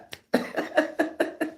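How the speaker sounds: high, unvoiced wheezing laugh; breathy high laugh. Real, not acted. Laughing fit.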